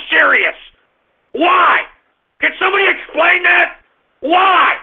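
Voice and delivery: high-pitched exasperated yelling; outraged shouting. Real, not acted. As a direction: rant at the top of your register, demanding an answer. Ranting in outrage at the story.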